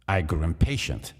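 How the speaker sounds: plain reading voice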